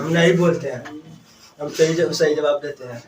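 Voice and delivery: loud protest. Real, not acted. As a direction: push back loudly at the teacher. Student rejects teacher's teasing accusation.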